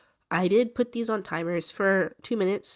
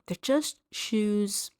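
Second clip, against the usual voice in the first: slow, drawn-out shrug of a line; dismissive; 2 semitones higher, much slower than usual. Retold or imitated. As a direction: brush it off, stretching just. Dismissive inner thought, drawn out.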